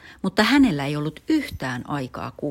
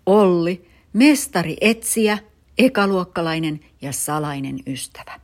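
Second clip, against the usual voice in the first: usual speaking voice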